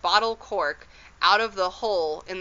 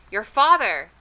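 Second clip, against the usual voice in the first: louder sudden cry; startled exclaim; 3 semitones higher, 7 dB louder than usual. A narrator's reading. Mother hearing her husband coming, exclaiming in alarm. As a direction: call out in a flurry. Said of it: "exclaimed Homily".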